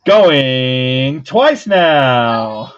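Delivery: loud, very drawn-out warning call; slow booming auction call; auctioneer's call. Real, not acted. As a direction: stretch it out loud to the bidders.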